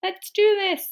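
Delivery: high-pitched little cheer; mock excited agreement. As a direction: squeal a keen yes as the character. Acting Jane's excited agreement.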